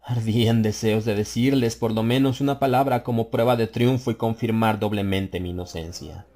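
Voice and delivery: ordinary delivery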